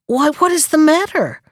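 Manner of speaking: high-pitched, puzzled question; storybook surprise, pitched well up; astonished question. A narrator's reading. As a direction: ask in mild astonishment. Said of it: "looking up with astonishment".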